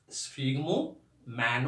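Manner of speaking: स्फिग्मोमैनोमीटर split into separate chunks, slowly; broken into parts. Shown as a demonstration. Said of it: "ये तोड़ तोड़ के आपको बोलना है".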